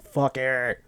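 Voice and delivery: put-on voice, throwing it away; hammed-up devil-may-care exclamation; mock reckless outburst. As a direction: imitate someone losing it and giving up. Acts out Simon losing it.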